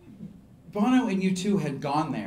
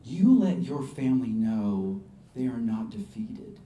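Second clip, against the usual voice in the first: very slow, lower, heartfelt charge; solemn encouragement; 4 semitones lower, slower than usual. Retold or imitated. Singer sending refugee home, solemn and slow. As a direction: give each word weight, earnestly.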